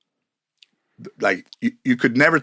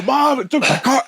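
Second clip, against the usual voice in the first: high-pitched panicked cry, voice way up. Retold or imitated. Grown son in the scene crying out to his mom, high and panicked. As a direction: wail to mom like the sky is falling.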